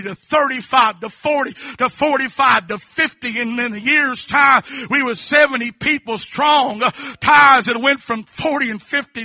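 loud, high, rhythmic preaching cries; full-force preaching. Real, not acted. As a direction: preach it out with fervour.

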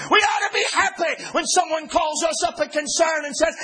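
fiery preacher building to a shout; full-force preaching. Acted. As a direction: drive the point home from the pulpit.

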